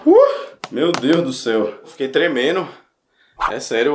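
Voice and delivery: normal talking voice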